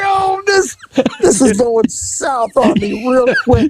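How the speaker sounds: high, strained mock panic, slow at the end. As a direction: squeal it out like you're caught. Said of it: "I'm like".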